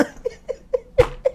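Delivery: wheezing silent laugh; airy wheeze of laughter; breathless laugh. Real, not acted. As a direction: laugh with no voice, just air.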